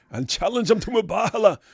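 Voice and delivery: mock an outraged opponent. Mocking quote of opponent.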